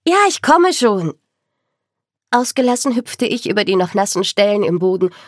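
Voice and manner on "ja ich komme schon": high, loud answering call; calling back. A narrator's reading. Tanja answers her sister from the kitchen, calling loudly. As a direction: shout back across the house.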